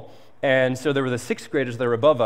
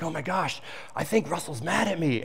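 softer, anxious aside to a friend; worried hush; 3 semitones higher, 6 dB quieter than usual. Retold or imitated. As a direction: confide your fear under the breath. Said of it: "I'm like".

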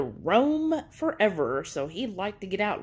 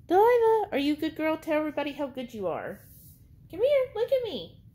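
very high, sing-song pet voice; 9 semitones higher, faster than usual. Real, not acted. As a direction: coo at the dog, pitch way up. Talking to her dog.

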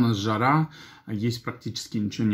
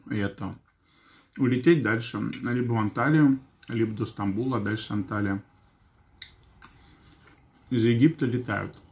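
chewing while talking; eating salad while speaking; mouth full. Real, not acted. Seen 3: Eats salad from fork throughout; cheeks full, chewing while speaking.

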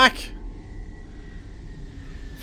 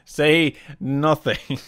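lower, drawn-out "Say nothing"; slow, deliberate warning; 7 semitones lower, 3 dB quieter, much slower than usual. Real, not acted. Gamer repeats warning to the lads, slow and deliberate. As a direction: stretch out each word knowingly.

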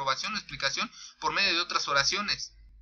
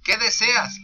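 higher, louder question on "¿Qué deseas?"; asking tone; 5 semitones higher, 7 dB louder, slower than usual. Shown as a demonstration. Teacher demonstrating a question with a raised, louder voice. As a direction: put a strong lift on "deseas". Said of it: "pregunta, signo de interrogación".

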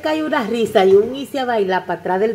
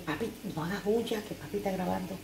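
soft hush to the little one, much softer; 11 dB quieter than usual. Real, not acted. Hushing child while filming.

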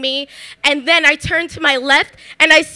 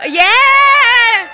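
loud high school cheer, much higher; 9 semitones higher, 7 dB louder than usual. Real, not acted. Cheering her high school Central, loud and high.

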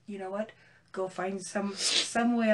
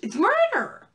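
raised high and loud; loud outrage; 9 semitones higher, 6 dB louder, slower than usual. Real, not acted. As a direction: insist it was murder. Outraged correction.

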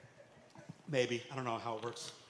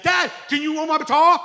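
put-on much higher, louder eager boy; shouted kid; 10 semitones higher, 11 dB louder than usual. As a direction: yell it like an impatient little son. Son begging dad.

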